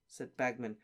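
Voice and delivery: ordinary delivery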